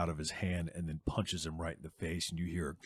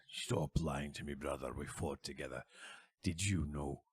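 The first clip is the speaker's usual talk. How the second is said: lower, quieter, menacing; low stern warrior; 4 semitones lower, 6 dB quieter than usual. Acted. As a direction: press him quietly and coldly. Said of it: "Durlin walks up to him and he goes".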